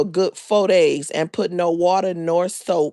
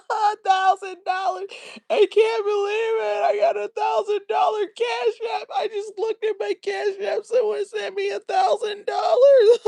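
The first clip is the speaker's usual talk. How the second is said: much higher, hammed-up sob-laughing; mocking streamer's teary laughing gush; mock crying laugh; 13 semitones higher than usual. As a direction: overplay fake tears of joy over the money. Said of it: "fake emotional".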